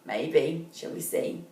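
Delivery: whispered, teasing question; whispering a question to young listeners; whispered aside. Acted. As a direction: lean in and whisper to the children. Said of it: "aside to listening children".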